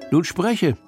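plain reading voice

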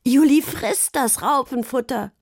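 put-on jeering kid; bawling. A narrator's reading. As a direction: crow it out to the whole table. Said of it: "gröhlte Pia".

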